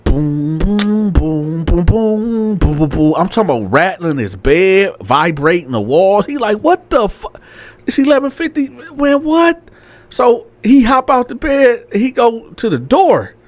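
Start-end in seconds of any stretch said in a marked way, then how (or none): 0.0-2.8 booming subwoofer. Retold or imitated. Storyteller mimicking the sub that wakes him, booming. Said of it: "he hearing that sub". 6.6-9.6 high-pitched baffled outburst; mock disbelief. Landlord woken by booming bass late at night, baffled.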